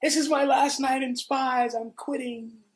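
high, slow mock proclamation; drawn-out, hammed-up declaration; grandstanding announcement. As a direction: imitate a big baby quitting in front of a crowd. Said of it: "in true grandstand baby style".

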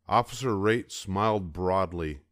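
ordinary delivery, nothing marked

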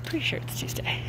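unvoiced uncertain whisper. Real, not acted. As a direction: whisper it as an aside. Whispered aside.

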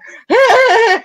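loud, high-pitched silly cackle; hammed-up giddy squeal of laughter; manic mock laugh. Host mocking herself beside the professional guest, manic laugh. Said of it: "and I'm like".

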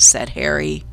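ordinary delivery, nothing marked

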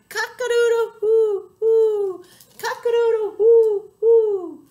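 very loud, high crowing hoot; swaggering shout. A narrator's reading. Owl puffs up his chest, loud swaggering crow. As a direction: crow proudly, ending in hoots. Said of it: "swaggered and shouted".

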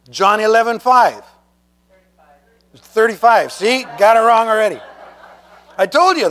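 ordinary delivery

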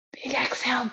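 whispered breathing cue. Real, not acted. Teacher whispering breath cue.

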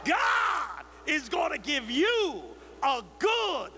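high, slow, shouting preacher; preaching at full force. Shown as a demonstration. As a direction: preach it to the rafters. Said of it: "I can do that".

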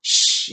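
"sh" as a long, hissed, unvoiced sh; sh sound. Shown as a demonstration. Teacher modelling the ch sound for students, a long hushed sh. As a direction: blow out a steady "sh" for the class. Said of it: "this word C H is sh".

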